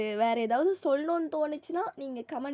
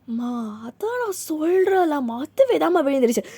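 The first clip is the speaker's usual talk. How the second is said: desperate pleading; 4 semitones higher, 8 dB louder than usual. Acted. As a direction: beg mum to believe you. Child pleads with yelling mother.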